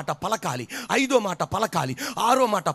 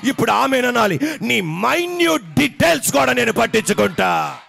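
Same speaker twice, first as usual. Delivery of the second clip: louder, rising, ringing sermon voice with long stretched words; full-force preaching; 2 semitones higher, 5 dB louder than usual. Acted. Pastor rousing the congregation, preaching loud and fervent.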